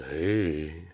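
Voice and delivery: low, soft crooning come-on; lightly put-on seducer, low and soft; smooth flirt. Acted smooth talker offering flowers.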